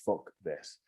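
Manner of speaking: low, soft grumble under the breath; muttered curse. Retold or imitated. Bad-tempered man muttering at the camp host, low and soft. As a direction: mutter it to yourself in a foul mood. I was in a really bad mood.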